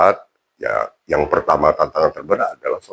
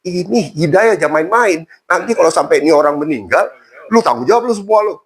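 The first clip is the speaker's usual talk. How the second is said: high-pitched mock scolding, pushing hard; retold scolding; 9 semitones higher than usual. Habib scolds the family for delaying the conversion, high and scolding. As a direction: scold them for holding back a convert. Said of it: "Diomelin mereka semua".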